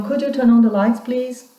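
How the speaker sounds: polite request. Shown as a demonstration.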